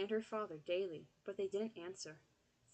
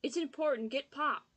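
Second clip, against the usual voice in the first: loud, high call; 6 semitones higher, 7 dB louder than usual. Acted. Boy yelling for Pop, loud high call. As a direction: yell it through the house.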